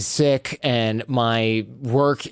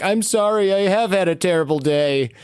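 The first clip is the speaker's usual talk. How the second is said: higher-pitched, retold crying apology; mock-weepy confession, pitched up; imitated tearful apology; 6 semitones higher than usual. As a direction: mimic someone apologizing through tears. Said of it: "And she started crying".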